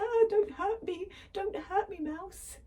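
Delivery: high, loud hammed-up pleading; scared lion. Lion hiding from the mouse, high mock pleading. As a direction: cower and beg, comically frightened. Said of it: "He's going".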